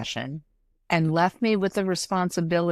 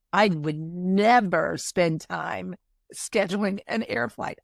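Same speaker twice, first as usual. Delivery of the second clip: usual speaking voice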